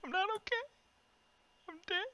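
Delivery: high, hammy fake sobbing; mock crying. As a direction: wail it like a mock tragedy. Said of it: "You okay?".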